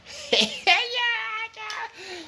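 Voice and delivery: squealing burst of laughter; shrill laughing squeal; high laugh. Real, not acted.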